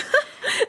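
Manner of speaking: breathy high giggle; a quick amused titter. Acted. [name] giggling at Becky's question about her brother.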